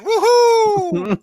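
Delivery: very high mock cheer; hammed-up Spider-Man woohoo; gleeful whoop. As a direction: whoop like a carefree hero.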